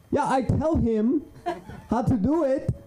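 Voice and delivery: very high put-on tutor voice; exasperated tutor. Tutor giving his side on the phone, exasperated high voice. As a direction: imitate the tutor in a high, insistent voice.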